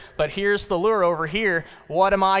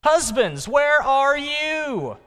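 God calling the husbands, building higher and louder; 9 semitones higher, 5 dB louder than usual. Acted. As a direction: preach it as God's summons to husbands. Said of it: "says God".

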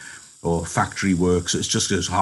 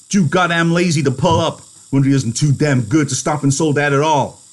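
angry rant, building louder and higher; 6 semitones higher, 5 dB louder than usual. Acted. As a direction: rant bitterly, getting more worked up as it goes. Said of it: "He said angrily".

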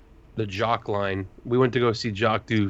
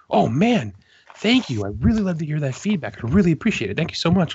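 higher, gushing thank-you in an imitated voice; imitating Dan; 7 semitones higher, 3 dB louder than usual. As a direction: play the grateful exec, pitched up and beaming. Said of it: "and he was like".

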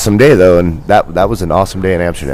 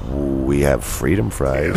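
slow, lower, deliberate correction; mock order-taker; 4 semitones lower, 4 dB quieter, much slower than usual. Restaurant worker correcting a customer's order, slow and deliberate. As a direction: drag it out as a pompous server.

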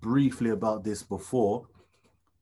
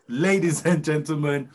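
slow, louder, drawn-out MC intro; grand entrance announcement; 4 semitones higher, 4 dB louder, slower than usual. Acted. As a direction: announce it like a ring MC. Intro again, slow build.

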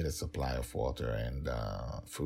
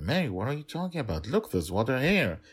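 higher, quick, incredulous brush-off; dismissive father; 9 semitones higher, 5 dB louder than usual. Acted. Dragon Father dismissing the nanny's worry, incredulous and quick. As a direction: wave her worry away, fast.

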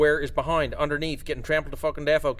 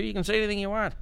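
ordinary talk, nothing marked

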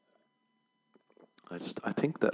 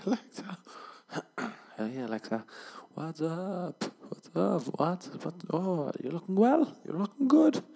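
high-pitched, boozy chatting-up, climbing higher; tipsy suitor; 10 semitones higher than usual. Acted. Tipsy man chatting up Alexa, high-pitched and gushing. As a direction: flirt with a few drinks in you. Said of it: "has to have a few drinks in him".

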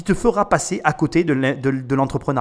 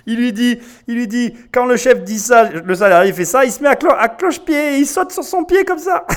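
laughing; 7 semitones higher, 4 dB louder than usual. Real, not acted. Retelling funny clip while laughing.

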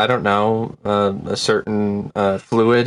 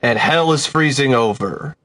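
news anchor voice; 5 semitones higher, slower than usual. Pretend. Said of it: "a news station was just like".

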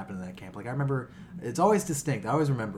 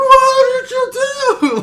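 much higher, louder, slow stunned squeak; confused kid; 16 dB louder, much slower than usual. Retold or imitated. Bully at camp reacting to the sucker punch, high and stunned. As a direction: play the dazed bully who just got hit. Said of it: "he was just confused by it".

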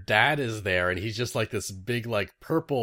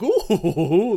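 high, laughing mock ooh; scheming ooh; 11 semitones higher, 9 dB louder than usual. Guest mimics the charm maker's scheming coo, laughing. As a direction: play the hand-steepling schemer. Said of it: "steeple their hands and go".

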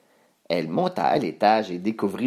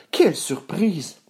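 higher, slow exclamation; surprised; 7 semitones higher than usual. A narrator's reading. Narrator as Boucle d'Or sees three bears, slow high surprise. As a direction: exclaim it with drawn-out astonishment.

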